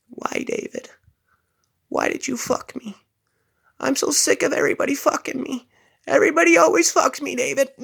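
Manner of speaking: high, fast, rising wail; hammed-up hysterical girl, high and fast; mock meth head girl. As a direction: whine it at David in a high voice. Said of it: "And she goes".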